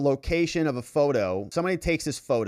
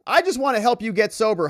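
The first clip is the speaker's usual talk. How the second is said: urgent plea; 9 semitones higher, 6 dB louder than usual. Acted. Pleading text read aloud.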